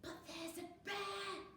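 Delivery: high, soft, slow urgent whisper; stage whisper. A narrator's reading. Fox warning friends, tense stage whisper. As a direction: stress the danger under your breath. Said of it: "whispered Fox".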